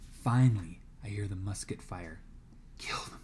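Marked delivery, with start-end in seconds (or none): 2.8-3.2 hissed under the breath; fierce whisper. A narrator's reading. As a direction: wish it silently, with venom. Silent wish during battle.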